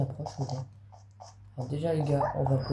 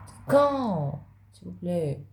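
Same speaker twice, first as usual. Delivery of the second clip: exaggerated pleading; 3 semitones higher, much slower than usual. Player begging Minecraft's makers for a release date, exaggerated pleading. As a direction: escalate into a theatrical, impatient plea. Repeats the plea, escalating.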